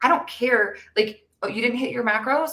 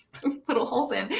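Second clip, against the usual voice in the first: laughing through the words, softer; 3 semitones higher, 5 dB quieter than usual. Real, not acted. To her co-host about poking holes in excuses, laughing softly. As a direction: let a laugh break into it.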